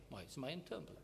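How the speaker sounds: a soft lenient excuse for a friend; mock indulgent shrug. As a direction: sigh out "Măi, se mai întâmplă" as if it's nothing. Mocking lenience toward friends' faults.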